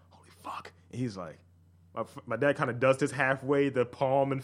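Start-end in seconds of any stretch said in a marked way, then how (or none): 0.1-0.7 low-voiced stunned whisper; mock-stunned hushed reaction; retold whispered aside. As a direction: whisper it to yourself in disbelief. Retold stunned reaction to dad's shtick.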